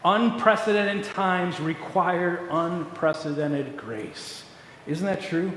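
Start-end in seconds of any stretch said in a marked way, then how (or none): 0.0-4.4 slow quote. Retold or imitated. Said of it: "She said".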